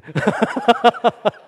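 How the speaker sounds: loud, high burst of laughter; hearty laugh. Real, not acted. Preacher laughing at his taxi evangelism story, real belly laugh. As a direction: crack up at your own story.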